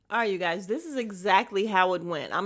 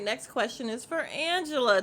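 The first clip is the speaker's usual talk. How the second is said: ordinary talk, nothing marked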